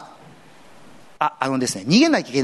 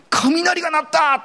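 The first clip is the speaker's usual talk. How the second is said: crowd member; 13 semitones higher, slower than usual. Acted. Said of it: "そばにいた群衆はこれを聞いて".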